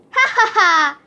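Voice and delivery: hammed-up ha-ha at own pun; fake laugh. Principal laughing at his own pun, hammed-up ha-ha. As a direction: chuckle at your own bad joke.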